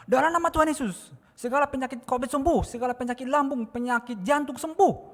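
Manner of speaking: high, forceful healing declaration; high-pitched shouted prayer; re-enacted prayer shout. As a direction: yell the prayer as if commanding sickness away. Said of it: "Jadi saya teriak".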